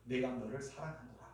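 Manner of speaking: hushed and soft, 내가 너를 사랑하노라 said close to the ear; 내가 너를 사랑하노라 murmured tenderly into the ear; soft whisper-like. Retold or imitated. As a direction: breathe 내가 너를 사랑하노라 softly like a secret. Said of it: "귀에 속삭이잖아요".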